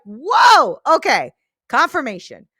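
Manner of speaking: loud, amazed whoa of recognition; surprised whoa. Retold or imitated. Host retelling her reaction to the podcaster's words, amazed. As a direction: gasp it as a sign arriving. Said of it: "It was kinda like".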